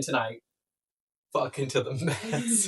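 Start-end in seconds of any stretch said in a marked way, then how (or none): 1.3-2.7 slow, playful "Fucking to the max."; drawn-out tease. Shown as a demonstration. Joking with a friend about a silly phrase, slow and playful. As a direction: stretch it out teasingly. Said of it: "Fucks it to the max is absurd".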